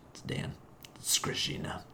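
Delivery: hushed, unvoiced whisper of the name; whispered reveal. Dramatic reveal of Dan's name.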